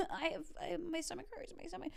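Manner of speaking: high, soft mock whimper; feeble excuse. Teenager caught by mom, feeble high-pitched excuse. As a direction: squeak out a sheepish excuse quietly.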